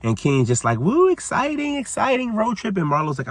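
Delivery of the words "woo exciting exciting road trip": high, sarcastic cheer; mock excitement. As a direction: fake enthusiasm, much higher. Kenya sarcastic about road trip.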